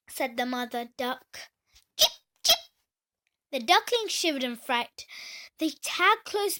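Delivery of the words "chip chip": high, loud chick peep; duckling peep. Acted. Ducklings peeping.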